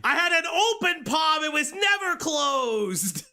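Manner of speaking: high yelled fake excuse; mock defensive shout. Mocking the streamer's excuse.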